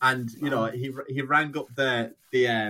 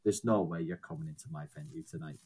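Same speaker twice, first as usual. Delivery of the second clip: lower, much softer stern refusal; gruff venue owner; 6 semitones lower, 4 dB quieter, faster than usual. Retold or imitated. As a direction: block the door like a grim bouncer. Venue owner turns band away.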